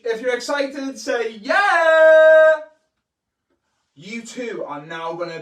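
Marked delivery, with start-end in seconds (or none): 0.1-1.3 higher, louder hype call, you stressed; louder cheer call. Acted. Calling viewers again after a weak answer, louder. 1.3-2.6 a much louder, higher "Yeah!" than the first; bigger cheer. Shown as a demonstration. Showing viewers how loud to cheer, big shout. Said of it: "can't hear that".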